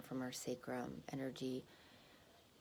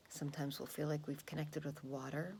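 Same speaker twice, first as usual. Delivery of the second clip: normal talking voice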